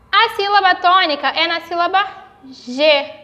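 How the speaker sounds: normal talking voice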